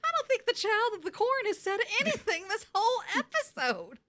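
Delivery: high, light mock voice; lightly mocking quote of herself; quoted self. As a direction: parrot her own remark, teasing. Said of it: "I'm like".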